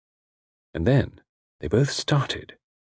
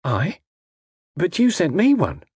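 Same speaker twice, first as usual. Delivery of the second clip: higher, astonished woman's voice; 9 semitones higher than usual. A narrator's reading. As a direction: protest in surprise. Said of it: "girl's eyes opened in astonishment".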